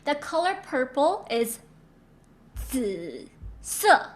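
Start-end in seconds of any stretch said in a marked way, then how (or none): 2.6-4.1 紫色 said slowly with the z like ds and a bouncing third tone; Mandarin zi se. Shown as a demonstration. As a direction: model 紫色 for students to copy. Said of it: "Repeat after me".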